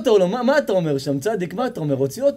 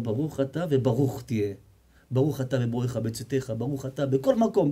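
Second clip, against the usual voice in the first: hushed, lower blessing said gently; soft blessing; 5 semitones lower, 6 dB quieter than usual. Acted. The rabbi softly blessing the rich man, hushed. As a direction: bless him quietly, almost under the breath.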